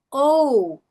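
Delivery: "ou" loud and stretched, o gliding into u; demonstrated letter-name "ou"; long O, two sounds. Shown as a demonstration. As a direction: say "ou" as a clear diphthong. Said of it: "dois sons de novo".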